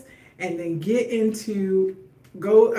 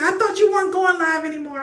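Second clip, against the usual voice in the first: imitating husband; 9 semitones higher, 4 dB louder, faster than usual. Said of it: "my husband said".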